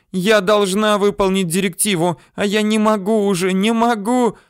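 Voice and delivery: put-on high, wailing girl's plea; high-pitched helpless outburst; desperate shouting. A narrator's reading. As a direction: cry out high, at the end of strength. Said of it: "кричала Йозге".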